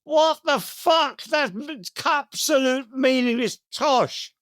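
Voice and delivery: what on earth is this, said slowly; incredulous scoff. Real, not acted. Scoffing at Tolkien quote.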